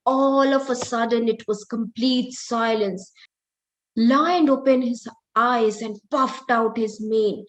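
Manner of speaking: ordinary delivery, nothing marked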